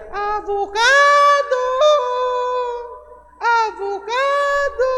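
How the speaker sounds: very high, slow, drawn-out street vendor cry; vendor's call. Retold or imitated. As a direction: call out like a market vendor hawking avocados. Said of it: "ang syagit niya". (taas kaayo nga syagit sa tigbaligya)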